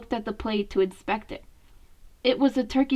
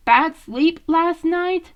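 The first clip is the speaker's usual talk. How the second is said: higher, louder, slow caring ask; concerned question; 5 semitones higher, 5 dB louder, slower than usual. Acted. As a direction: ease the question out gently. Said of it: "Leo asked".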